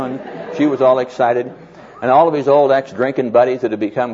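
normal talking voice

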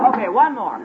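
high, loud pep-rally calls; booming motivational speech. Acted. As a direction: rouse the crowd to their feet. Shouting encouragement.

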